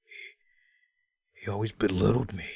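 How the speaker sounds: monotone, low and quiet mumble; mock a mellow wrestler, with long pauses between phrases; passionless, sedated drone; Batista. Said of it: "It was just so fucking monotone".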